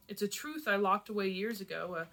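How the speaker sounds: plain reading voice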